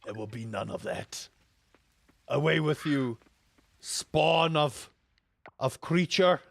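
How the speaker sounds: a hint of a villain casting out a spawn; lightly hammed-up dismissal. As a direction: shoo the creature away with mock solemnity. Banishing a crying baby.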